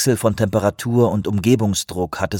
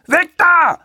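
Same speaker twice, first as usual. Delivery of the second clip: very high, loud warning scream; 5 dB louder than usual. Acted. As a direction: scream at them to get clear. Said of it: "schrie er".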